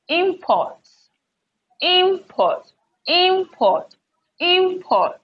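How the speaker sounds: IMport, IM high and long, port dropping; stress on first syllable. Shown as a demonstration. Teacher drilling import as a noun, IM stressed high. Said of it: "so the stress falls on the first".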